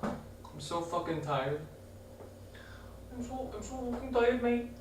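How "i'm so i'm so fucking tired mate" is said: high, soft put-on Irish accent; attempted Irish lilt, a bit raised and hushed. Shown as a demonstration. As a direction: try out a funny Irish voice, softly. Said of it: "things sounding Irish".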